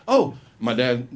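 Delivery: ordinary delivery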